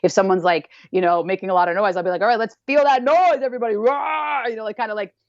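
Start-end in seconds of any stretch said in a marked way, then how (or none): 2.2-4.4 rallying the class. Retold or imitated. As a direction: whip up the room to roar together. Calling out to the class.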